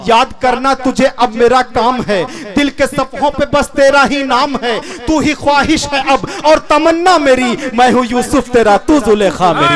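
measured, declaiming recital of a love poem; poetry recital. Acted. Poet reciting his nazm to the mushaira audience, declaiming. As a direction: recite each couplet to the gathering.

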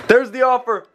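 high-pitched, thrilled; giddy excitement. Real, not acted. Prankster seeing the trade offer appear, giddy. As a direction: squeal it to the viewers as it works.

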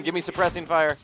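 loud tactical callout; cover me shout. Real, not acted. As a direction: call for cover loudly. Team callout.

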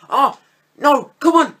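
high, loud frustrated groan of "come on"; frustrated outcry. Real, not acted. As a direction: plead at the screen in frustration. Near miss in the game.

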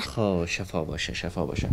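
low, slow, soft well-wishing; soft sympathy. Real, not acted. Host consoling a child about a sick father, soft and sympathetic. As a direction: say it gently, dropping low and soft.

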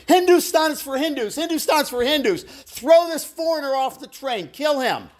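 high-pitched mob chant, retold; imitated angry yelling. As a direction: imitate a hostile crowd yelling on a train. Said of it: "They started yelling".

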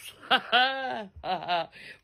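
low gloating laugh; scheming chuckle. Real, not acted. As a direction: chuckle over the coming prank. Laughing about planned prank.

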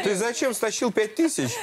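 high playful telling-off; mock scolding dog. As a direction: tease the dog like a naughty child.

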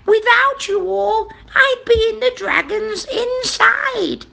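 very high witch voice; relieved, high-pitched story witch; grateful witch's cry. A narrator's reading. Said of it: "the grateful witch cried".

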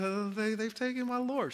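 a touch of tearful pleading; grieving Mary. A narrator's reading. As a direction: voice Mary's loss, a little broken. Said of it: "She said".

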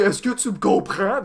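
slow, high mock French girl; hammed-up feminine French voice; exaggerated feminine French girl. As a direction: mimic a movie-style Frenchwoman, drawn out. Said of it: "like, feminine, with, like, really weird".

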